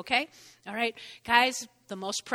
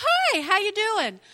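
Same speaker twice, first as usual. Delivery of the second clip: retold friendly yell; 10 semitones higher, 6 dB louder, slower than usual. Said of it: "she just yells through".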